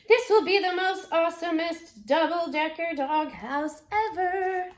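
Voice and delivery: loud, high, sing-song ending; thrilled. Acted. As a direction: proudly belt out the brag. Boys together, thrilled at result.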